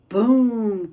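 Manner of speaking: a big booming sound word; loud boom. Acted. Narrator booming the monster's noise. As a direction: boom it out like a crash. Sound effect in read story.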